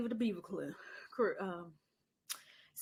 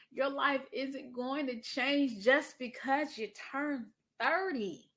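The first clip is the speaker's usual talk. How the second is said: slow, higher and louder, each word pressed; slow, loud and stern, like a mentor to a young woman; emphatic lecture; 5 semitones higher, 5 dB louder, much slower than usual. Retold or imitated. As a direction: hammer the point home to a young woman. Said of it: "lecturing Brittany".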